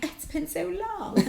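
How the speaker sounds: very soft, high breathy confession; near-whisper. Pretend. Woman to a new lover, almost whispering. Imagined date line.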